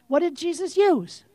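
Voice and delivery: ordinary talk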